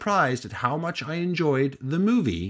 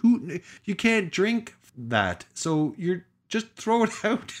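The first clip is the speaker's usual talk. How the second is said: mock exasperated self; 9 semitones higher than usual.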